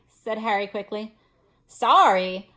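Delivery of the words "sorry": loud angry bark. A narrator's reading. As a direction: snap it back, loud and offended. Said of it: "barked Hagrid".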